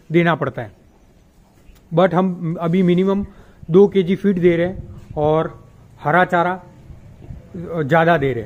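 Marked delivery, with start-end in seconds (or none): none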